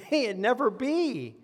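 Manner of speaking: high, slowed, solemn insistence on never; emphatic answer. Retold or imitated. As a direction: declare it can never happen. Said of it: "he has a very emphatic answer".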